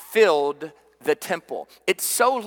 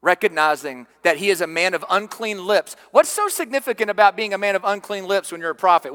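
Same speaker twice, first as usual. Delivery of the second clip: normal talking voice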